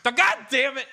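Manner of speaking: high, loud put-on cursing; mock exasperation. Escaped parakeet gets caught resting, mock-outraged yell. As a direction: curse like a hammy recaptured escapee.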